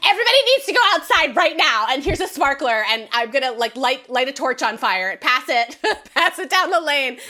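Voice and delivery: hammed-up buzzing event planner; hyper wedding coordinator. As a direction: play a wired coordinator herding guests outside. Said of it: "wedding coordinator ... is like".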